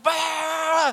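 loud, high "bah" of ranting; wordless hammed-up preacher bellow; mock preaching noise. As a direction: blare it like a parody rant. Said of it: "I'm preaching to her".

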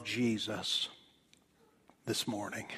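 a sudden hushed aside; whisper. Real, not acted. Preacher to congregation, whispering the close of the line. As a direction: breathe the words out in a whisper.

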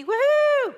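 loud, high cheer of joy; real whoop of excitement; excited whoop. Real, not acted. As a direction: shout it out with glee. Excited about walking in purity.

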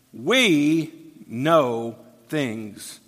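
"we" stretched very slowly and leaned on; stress on we. Shown as a demonstration. Preacher showing the Pharisees' boast, slow stress on "we". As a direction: drag out "we know things", weight on "we". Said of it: "the "we" found in these verses is emphatic".

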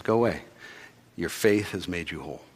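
soft, tender asking; Jesus tenderly asking the blind man; gentle question. Retold or imitated. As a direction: ask him quietly and kindly.

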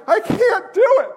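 high, loud scaredy-cat wail; comic high-pitched cry of fear; mock panicked whine. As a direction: protest like a frightened child, hammed up. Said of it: "told me to shut up and quit crying".

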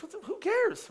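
high, soft "who cares"; comic dismissal. Acted. Forty-one-year-old shrugging off his nose, comic dismissal. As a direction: brush it off in a high pitch.

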